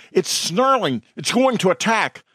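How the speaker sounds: high-pitched alarm; alarmed cry. A narrator's reading. Bystander fears the wolves will attack, crying out high. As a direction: cry a warning to the crowd.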